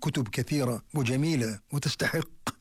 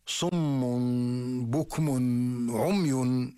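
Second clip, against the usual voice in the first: slow recitation; 2 semitones lower, much slower than usual. Acted. Reciting the Quran verse.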